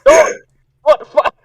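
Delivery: high, startled what the fuck; shocked disbelief. Real, not acted. Game master stunned by the player's crude line, high disbelief.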